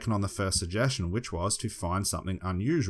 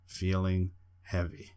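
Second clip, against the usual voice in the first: slow hypnotist voice; 3 dB quieter, much slower than usual. Shown as a demonstration. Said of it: "or you just look at them and just go".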